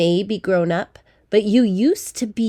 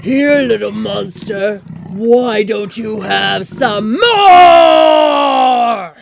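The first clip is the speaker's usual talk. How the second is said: loud roaring monster shout, slowing to a bellow; 12 dB louder, much slower than usual. Acted. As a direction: roar it like a monster. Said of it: "They'd probably snarl, and they'd definitely roar".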